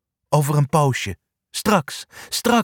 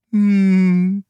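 long, high, level hum; humming in reply; contented hum; 7 semitones higher, 3 dB louder than usual. A narrator's reading. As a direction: hum one sustained note. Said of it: "neuriet Lola".

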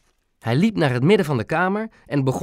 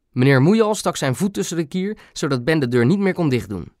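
ordinary delivery, nothing marked

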